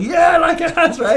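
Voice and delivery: high, loud eager yes; excited audience guy. Retold or imitated. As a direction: blurt it out like a pumped-up guy in the crowd. Audience guy answering Tony on stage.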